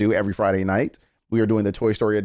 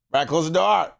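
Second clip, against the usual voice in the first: repeated order; 6 semitones higher than usual. Real, not acted.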